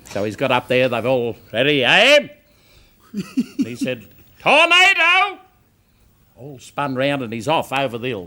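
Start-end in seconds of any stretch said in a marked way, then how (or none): 1.5-2.3 loud drill order; firing squad command. Acted. Officer readying the firing squad, loud command. 4.4-5.4 very high, loud false alarm; mock alarm shout. Prisoner tricking the firing squad, mock alarm shout.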